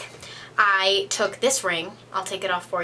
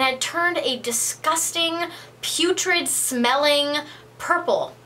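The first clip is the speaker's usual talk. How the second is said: slow dramatic build; 4 semitones higher, slower than usual. Acted. Telling viewers about the ruined ring, slow and dramatic. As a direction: drag out purple slowly, relishing the disgust.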